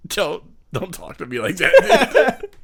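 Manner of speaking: slow, laugh-broken objection; laughing protest. Real, not acted. Friend objecting to being called partner, laughing. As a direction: object through real laughter, drawing it out.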